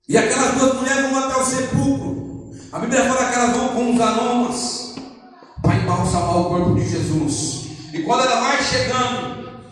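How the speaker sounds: high-pitched, driving sermon delivery; impassioned preaching. Acted. Preaches at full force.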